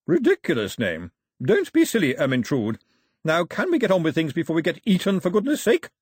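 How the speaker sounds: loud, high imperious scolding; grandmother, scornful. Acted. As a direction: dismiss it with haughty impatience.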